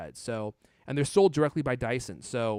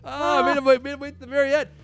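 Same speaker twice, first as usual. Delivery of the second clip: excited; 12 semitones higher, 9 dB louder than usual. Real, not acted. Excited after catchphrase.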